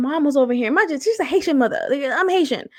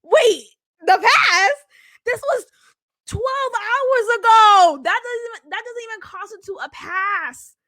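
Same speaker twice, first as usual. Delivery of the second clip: incredulous outrage; 9 semitones higher than usual. Real, not acted. Ranting to viewers about his twelve-hour past claim. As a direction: voice rising in disbelief at the past.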